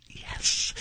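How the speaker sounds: breathless whisper; secretly relieved, under your breath; whispered relief. Retold or imitated. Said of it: "And I'm like".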